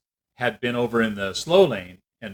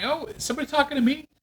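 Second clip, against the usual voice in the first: much higher, quick mock-innocent question; 14 semitones higher, 4 dB quieter, faster than usual. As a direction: play dumb, high and fast.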